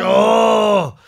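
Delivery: high, loud imitated moan; matching mock groan. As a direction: answer with the same hammed-up bellow. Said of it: "Exactly the same one".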